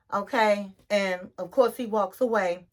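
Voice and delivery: ordinary talk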